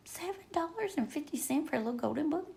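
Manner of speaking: quick, hushed, rising disbelief at the price; incredulous. Real, not acted. Repeating the price to viewers in hushed disbelief. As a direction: scoff at it, half under her breath.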